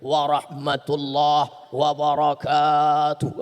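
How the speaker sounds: very slow, low, drawn-out formal preacher's greeting; Zainuddin's formal salam. Retold or imitated. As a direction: stretch every syllable like a grand old-school preacher. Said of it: "Dari mulai salam, ... lihatlah bagaimana Zainuddin".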